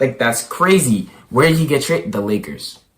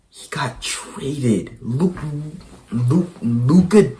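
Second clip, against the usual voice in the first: stunned hush; 3 semitones higher, much slower than usual. Real, not acted. Stunned slow announcement.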